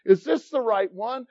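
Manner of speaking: ordinary talk, nothing marked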